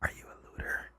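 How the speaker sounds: breathy whisper right in the ear; voiceless whisper close to the ear; creepy ear whisper. Acted. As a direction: whisper it like a stalker. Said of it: "got into your ear and said".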